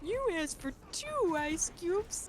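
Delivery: hammed-up cartoon reveal, rising in pitch; cartoon prankster's confession. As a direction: draw it out, then cry the punchline.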